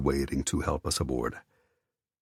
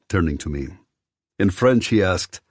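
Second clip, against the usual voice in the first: usual speaking voice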